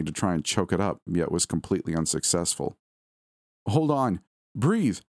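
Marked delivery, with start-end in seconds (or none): 3.7-5.0 higher, urgent plea; anxious, insistent entreaty; pleading. A narrator's reading. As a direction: beg someone to hang on. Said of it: "I pleaded".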